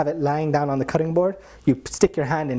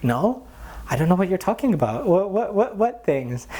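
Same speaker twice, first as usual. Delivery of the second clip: higher, flustered what-what-what; mock denial; 6 semitones higher than usual. Guru denies eating sweets, high flustered mock denial. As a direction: bluff innocence in a rush.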